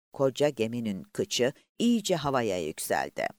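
ordinary delivery

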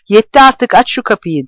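high, loud, fed up and raging; furious shout. Acted. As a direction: bellow that enough is enough. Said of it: "diye yineleyip duruyordu". (öfkeli, yüksek sesli bağırış)

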